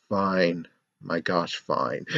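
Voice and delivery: low, soft grudging surrender; Jude Lynn. Acted. Co-writer giving in to the script requests, resigned. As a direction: give in under your breath. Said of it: "that's what I believe Jude Lynn is saying".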